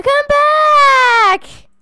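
loud, high and slow call after someone. Real, not acted. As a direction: call after him as he runs off. Chasing villager, calling out.

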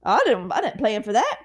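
a silly panicked God; flustered God voice. Pretend. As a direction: play God as totally surprised. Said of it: "I don't know why I did that voice".

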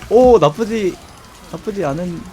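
usual speaking voice

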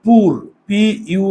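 পুর said slowly, then spelled letter by letter as পি ইউ. Shown as a demonstration. Teacher spelling an English word for students, slowly. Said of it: "ইংরেজিতে একটু translate করে দেখি".

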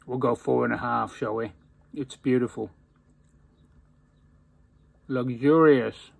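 talking around pipe stem. Real, not acted. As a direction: pipe clenched in teeth. Seen 4: Pipe stem held between lips throughout the labelled words, while talking.

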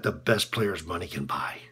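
low, soft, sour remark; quiet, low-pitched disdain; low bitter aside. Acted. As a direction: say it under your breath with contempt. First take, muttered about Happy.

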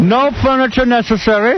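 squeaky comic customer asking politely; mock terrorist buyer. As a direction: play a hammed-up high-voiced buyer begging Trump. Mock buyer keeps talking to Trump.

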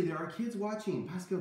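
ordinary talk, nothing marked

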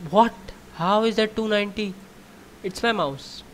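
high whining complaint; blaming the mouse; whiny. Real, not acted. Whining about bad score.

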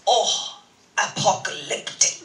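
a hint of Austrian, deadpan; mild Austrian impression, savoring the word; Austrian accent. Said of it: "And he goes".

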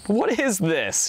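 high, drawn-out cry of disbelief, little added volume; narrated yell. A narrator's reading. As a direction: exclaim it high and slow, without really shouting. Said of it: "yelled the second man".

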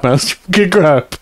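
normal talking voice

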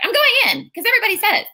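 high, fast, breezy declaration; retold naive eagerness; gung-ho resolve. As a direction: rattle it off high and headlong. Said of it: "I just was like".

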